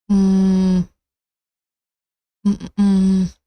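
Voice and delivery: ordinary talk, nothing marked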